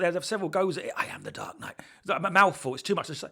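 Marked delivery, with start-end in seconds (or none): 0.9-1.8 lightly low Batman line; a hint of Batman growl. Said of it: "there was a mouthful".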